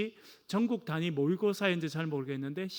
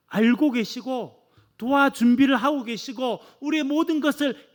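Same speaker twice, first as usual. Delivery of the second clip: fervent preaching; 6 semitones higher, 7 dB louder than usual. Acted. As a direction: preach with force toward the congregation. Preaching at full force. (힘차게 높이 외치는 설교)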